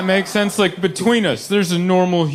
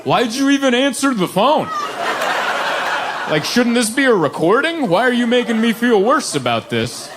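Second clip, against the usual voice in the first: mock indignation; 8 semitones higher than usual. Caller protests to insurance lady.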